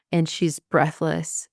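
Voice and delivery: ordinary talk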